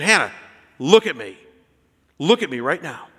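firm, spaced-out commands; fatherly authority. Retold or imitated. Dad getting his frantic daughter's attention, firm authority. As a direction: make her look up, with measured insistence. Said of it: "I asserted a little bit of authority".

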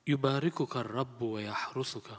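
ordinary talk, nothing marked

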